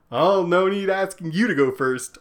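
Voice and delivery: high, loud, brusquely rude imitation. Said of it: "she is so rude".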